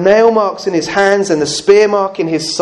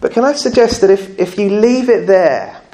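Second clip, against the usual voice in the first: ordinary talk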